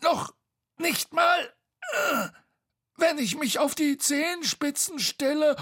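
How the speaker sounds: very high, squeezed, halting voice; straining call. A narrator's reading. Bo reaching from atop Tete, high and straining. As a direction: strain upward in broken bits. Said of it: "rief Bo von oben".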